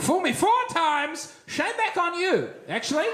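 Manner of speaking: hammed-up outrage; mock indignant. Comic twist of the proverb.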